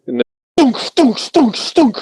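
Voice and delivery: high rhythmic mouth gunfire; gun firing noise. Retold or imitated. Narrator imitating the turret cannon, mouth sound effect. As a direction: mimic the cannon thumping.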